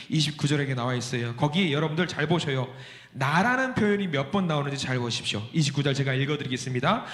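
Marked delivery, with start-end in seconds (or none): none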